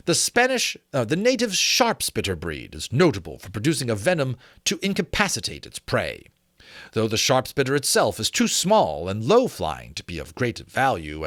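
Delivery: measured, steady book-reading voice; story narration. Acted. Narrating a dragon history book to the viewers, in a measured reading voice. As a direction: read the old treatise like a learned gentleman.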